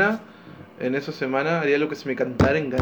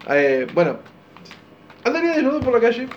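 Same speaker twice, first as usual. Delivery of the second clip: ordinary talk, nothing marked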